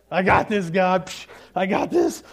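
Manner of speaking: loud, cocky and dismissive; cocky brush-off. Retold or imitated. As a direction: brush God off like you know better. Cocky self toward God.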